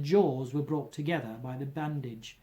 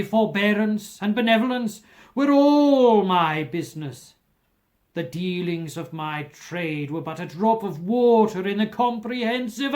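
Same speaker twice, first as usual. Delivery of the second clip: anguished ghost; 3 semitones higher, 10 dB louder than usual. A narrator's reading. Marley's ghost crying out its regret to Scrooge, loud and building. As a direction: let the ghost's grief swell into a loud cry.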